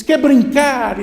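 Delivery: high, loud question; shouted invitation. Acted. Boy invites the hidden child to play, shouting. Boy calls friendly offer.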